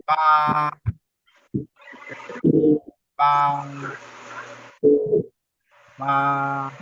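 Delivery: "Ba" held on one level pitch; even, drawn-out "Ba"; constant first tone. Shown as a demonstration. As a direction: sustain "Ba" without movement. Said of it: "Le premier ton et le ton constant".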